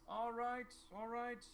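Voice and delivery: high-pitched, drawn official voice; town advisor. Acted. Advisor coming out to the town hall, placating. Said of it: "the advisor comes out and he".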